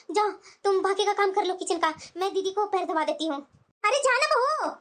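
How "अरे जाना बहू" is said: higher-pitched, impatient command; impatient order. Acted. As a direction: shoo the daughter-in-law off. Mother-in-law orders daughter-in-law away. (झुंझलाकर हुक्म)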